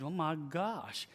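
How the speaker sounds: slow, stretched-out astonishment; drawn-out, weary amazement; exasperated disbelief. Retold or imitated. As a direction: draw it out in mock dismay. Recounting his baffled reaction.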